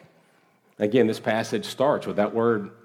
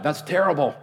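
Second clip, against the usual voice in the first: higher-pitched mock outburst; imitated yell; 7 semitones higher than usual. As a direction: imitate a shocked heckler calling out. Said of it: "someone just yelled out".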